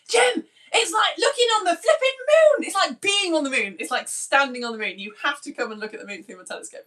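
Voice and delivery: high, loud burst easing into urgency; retold wild excitement. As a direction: re-enact waking someone with thrilling news. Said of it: "I was so excited".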